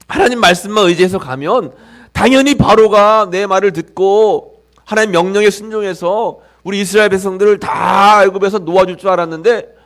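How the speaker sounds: normal talking voice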